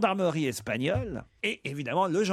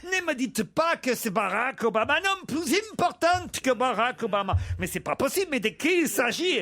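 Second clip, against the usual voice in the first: put-on higher-pitched incredulous chief, Spanish accent; 7 semitones higher than usual. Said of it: "tu peux faire l'accent espagnol". (accent espagnol, ton incrédule)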